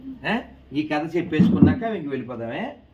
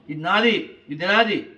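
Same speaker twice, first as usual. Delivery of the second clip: loud, higher-pitched grabby squabble; ఇది నాది, ఇది నాది in a loud childish quarrel; squabbling kids; 4 semitones higher, 6 dB louder, slower than usual. Retold or imitated. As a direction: mimic two kids fighting over a toy. Children quarrelling over one thing.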